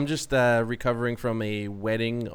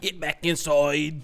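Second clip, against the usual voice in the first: mock Southern accent; 4 semitones higher, 3 dB louder, slower than usual. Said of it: "Jason's accent that he used".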